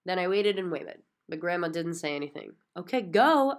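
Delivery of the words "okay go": higher and much louder. A narrator's reading. Girl urging grandma to speak up, louder. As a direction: push it out louder, insisting. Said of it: "I said louder".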